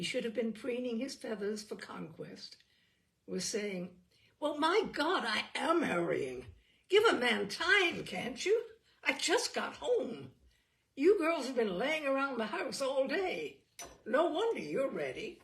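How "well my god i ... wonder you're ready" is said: louder, exasperated snapping; a harried brother snaps at his sisters to give him time. Acted. Joe snaps at waiting sisters.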